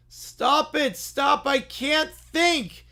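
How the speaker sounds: high, pleading cries of stop, slow at the end; desperate plea. Acted. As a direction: beg the kids to stop so you can think. Oswald covers ears, pleads.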